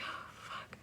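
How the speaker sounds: breathless whispered curse; whispered dread. Retold or imitated. Stoned worker dreading calling out an order, whispered. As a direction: mutter it under your breath.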